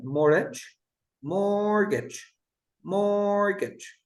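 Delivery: mortgage drawn out slowly, no T; silent-T pronunciation. Shown as a demonstration. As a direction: model mortgage word by word, leaving out the T. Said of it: "And with the T".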